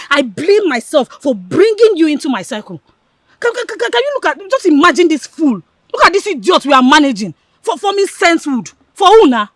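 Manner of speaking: loud, high-pitched angry rant; heated, insulting outburst; contemptuous tirade. Acted. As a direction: berate your friend as a fool. Insults friend as fool and idiot.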